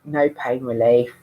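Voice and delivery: usual speaking voice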